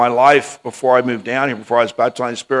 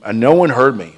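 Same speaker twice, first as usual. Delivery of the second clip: ordinary delivery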